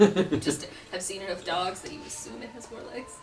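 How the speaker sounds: normal talking voice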